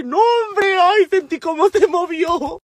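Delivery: high, startled squeal of horror; high-pitched comic panic, drawn out at the end; grossed-out alarm. Retold or imitated. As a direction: relive the shock of it squirming. Reliving the sponge moving in hand.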